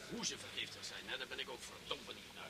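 low voice so nobody overhears; hushed. Acted. Police chief lowering his voice to the doctor, hushed. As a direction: keep it down, people are listening. Others listening, talks low.

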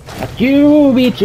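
high, loud taunting shout; defiant yell. Real, not acted. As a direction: scream it back at the monster. Yells at monster while fleeing.

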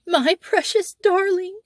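high, frantic wail of a terrified mother. A narrator's reading. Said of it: "wailed the mother".

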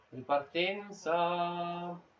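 very slow, stretched commentator call; drawn-out call. Real, not acted. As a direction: drag the word out like a broadcaster.

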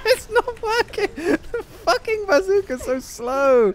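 high, helpless laughing complaint. Real, not acted. Player griping about slow bazooka, laughing. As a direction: squeeze it out through laughter.

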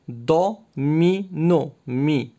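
Domino drawn out slowly, syllable by syllable; split into syllables. Shown as a demonstration. Teacher to pupil, sounding out Domino slowly. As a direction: stretch out each syllable of Domino. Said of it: "Frappe les syllabes".

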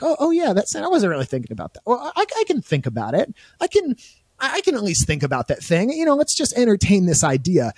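high, coy voice talking itself into it; sing-song rationalising, high and innocent; mock tempted sinner. Host acting out how sin starts, coy and high. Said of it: "It's like".

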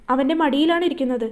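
usual speaking voice

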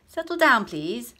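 high, loud call for order; firm call. Acted. Teacher calling the laughing class to order, loud. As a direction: raise the voice over the class. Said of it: "teacher calms laughing class".

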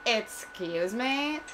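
put-on slow, affronted Valley Girl drawl. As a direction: stretch it like a snooty Valley Girl. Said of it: "like Valley Girl".

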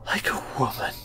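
whispered, barely voiced echo; under her breath. A narrator's reading. Girl repeats the stranger's remark to herself, under her breath. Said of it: "she repeated under her breath".

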